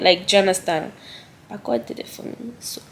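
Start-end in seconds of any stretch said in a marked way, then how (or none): 1.5-2.8 hushed. Real, not acted. Grateful hushed testimony.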